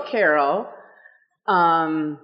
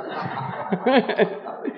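soft laugh; 2 semitones higher, 4 dB quieter than usual. Real, not acted. Laughs after joke.

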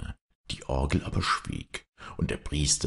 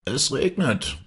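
comfortable village mayor; 9 semitones higher, slower than usual. A narrator's reading. Village mayor at his window, greeting the couple contentedly. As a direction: say it contentedly, moderately high. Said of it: "sagte der Vetter Schulze, der behaglich".

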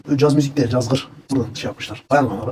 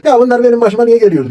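mock whining complaint; 9 semitones higher, 7 dB louder than usual. Quoting a victim's complaint.

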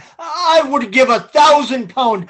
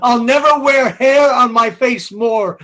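normal talking voice

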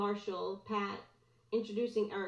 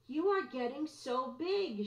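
put-on warm older woman; 5 semitones higher than usual. A narrator's reading. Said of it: "genuine warmth in her voice".

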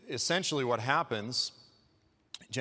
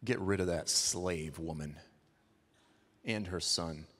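mock cold command; 8 semitones lower, 3 dB quieter than usual. [name] voiced, then called mad.

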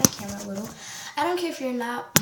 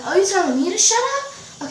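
high, louder acted disbelief; indignant challenge; 7 semitones higher, 5 dB louder than usual. Her comeback to the boy, indignant challenge. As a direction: throw it back at him, affronted.

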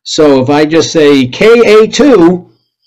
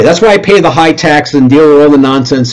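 ordinary talk, nothing marked

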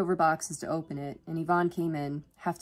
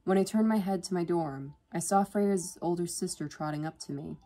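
ordinary delivery

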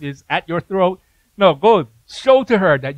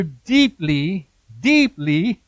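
a slow, weighty "deeply", repeated for emphasis; much slower than usual. Real, not acted. Preacher reading scripture to the congregation, stressing DEEPLY. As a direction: lean hard on "deeply", drawn out slowly each time. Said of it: "I gotta emphasize that word".

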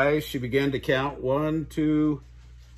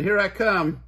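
louder, higher hide-and-seek call; playful call; 8 semitones higher, 6 dB louder than usual. A narrator's reading. Mama Duck calling out to her hidden ducklings, louder and higher. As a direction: sing it out to warn the hiders. Mama ends counting to ten.

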